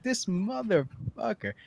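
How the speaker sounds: half amused, half beaten; muttered disbelief. Retold or imitated. Disbelief at the scammer.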